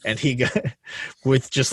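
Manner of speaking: ordinary talk, nothing marked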